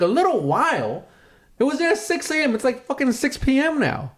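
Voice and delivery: high indignant retort; incredulous echo. Retold or imitated. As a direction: throw his words back in disbelief. Echoes cop in disbelief.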